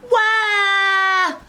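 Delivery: high, loud acted shriek; long high scream of fright; startled scream. Girl wakes to three bears over her, startled scream. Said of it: "And she's like".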